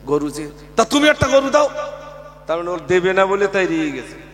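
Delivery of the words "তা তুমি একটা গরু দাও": high, loud imitated angry demand; imitating the annoyed villager. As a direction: mimic the irate man demanding. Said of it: "তা সে লোক খুব রেগে গেছে".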